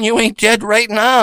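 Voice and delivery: ordinary talk, nothing marked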